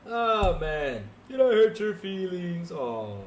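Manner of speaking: loud, drawn-out fake pity; mock sympathy. Streamer taunting a viewer over hurt feelings, mock sympathy. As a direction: stretch it out slowly to tease.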